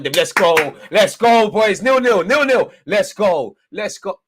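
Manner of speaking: loud, high, jubilant chant of let's go; excited shouting. Real, not acted. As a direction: roar it like the whole stand is with you. Excited fan celebrating the draw.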